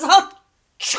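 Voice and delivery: high theatrical achoo; exaggerated sneeze; big sneeze. Wolf sneezes.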